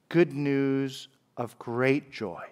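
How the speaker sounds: Good news of great joy, dicho despacio y bajito como con asombro; hushed and slow. Shown as a demonstration. Savouring the angel's phrase.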